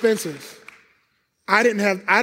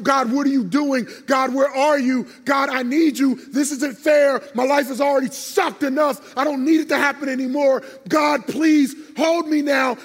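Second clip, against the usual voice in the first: raised, higher-pitched retold prayer; desperate plea; 6 semitones higher than usual. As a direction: plead with God, pitch climbing toward the end.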